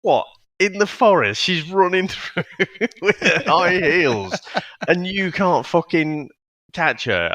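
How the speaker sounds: high disbelieving what-the; can't believe what you're watching; incredulous. Retold or imitated. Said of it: "And I'm thinking".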